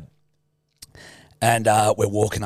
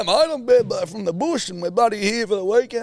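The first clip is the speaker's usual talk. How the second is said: tipsy dad; 11 semitones higher than usual. Retold or imitated. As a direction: play the merry dad chatting up the driver. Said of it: "Dad's going".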